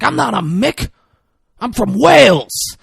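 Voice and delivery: high-pitched mock protest; imitating an indignant yell. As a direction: protest it loudly, voice jumping up. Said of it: "the gruff starts yelling".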